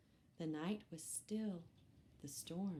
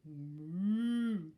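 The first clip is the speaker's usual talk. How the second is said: cow moo; 7 dB louder than usual. Acted. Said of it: "said Cow".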